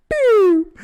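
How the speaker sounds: a loud, very high "pew" sound effect; deflating pew sound. Acted. Joking his confidence just vanished, loud high pew.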